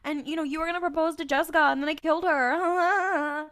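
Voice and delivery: high, taunting sneer; mocking demon. Demon taunting Sam about his powers, high mocking sneer. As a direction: jeer at him like a gloating demon. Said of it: "he starts mocking Sam".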